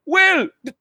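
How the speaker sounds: high, loud acted protest; squealed, halting complaint while being stabbed; exasperated victim. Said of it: "he's like".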